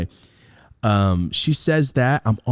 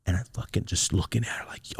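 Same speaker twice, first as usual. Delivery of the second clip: whisper; 2 semitones lower, 8 dB quieter than usual. Real, not acted. Hiding outside, watching mom.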